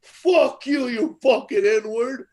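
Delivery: high mock yell at the doorman; thug yelling. Thrown-out thug yelling at Brother Woods. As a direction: spit the insult up high. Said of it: "He goes".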